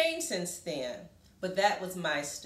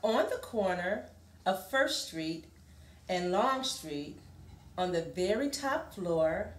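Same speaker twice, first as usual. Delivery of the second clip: lively, sing-song picture book reading; reading to children. Acted. Teacher reading a picture book to a class, lively.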